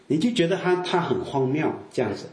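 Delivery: ordinary delivery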